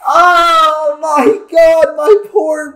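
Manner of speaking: very high, loud screaming with drawn-out words; furious wailing. Real, not acted. Gamer screaming at his opponent after losing his Pokemon. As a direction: rage at the screen, voice cracking. Raging at opponent's lucky crit.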